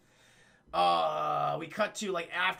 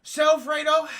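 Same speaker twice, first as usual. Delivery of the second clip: sharp call; 11 semitones higher, 13 dB louder than usual. Retold or imitated. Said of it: "And Michael goes".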